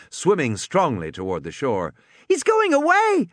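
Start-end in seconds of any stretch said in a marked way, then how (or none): 2.3-3.3 put-on high, loud delighted girl's squeal; gleeful squeal. A narrator's reading. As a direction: squeal with joy. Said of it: "Lex squealed".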